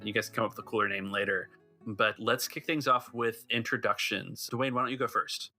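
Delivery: normal talking voice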